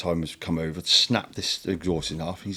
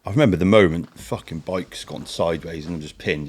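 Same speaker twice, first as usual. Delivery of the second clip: ordinary talk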